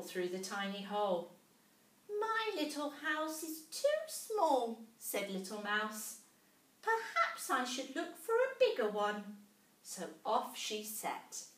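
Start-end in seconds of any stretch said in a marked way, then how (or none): 2.1-4.8 high, slow squeaky mouse; a tiny mouse gasping in wonder; little mouse voice. A narrator's reading. 6.8-9.4 a tiny mouse gasping in wonder; little mouse voice. A narrator's reading.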